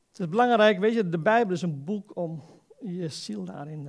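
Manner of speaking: ordinary talk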